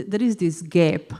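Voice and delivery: usual speaking voice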